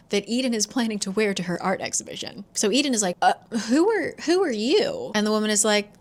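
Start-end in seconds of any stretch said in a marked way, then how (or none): 3.2-5.1 higher, stammered challenge; taken-aback demand. Retold or imitated. Eden facing a woman in her own doorway, taken aback. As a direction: blurt it out, thrown by a stranger.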